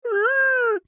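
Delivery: high, strained crying creature wail; mock Pokemon crying noise; crying Lapras. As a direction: cry out like a hurt sea creature. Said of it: "She's like".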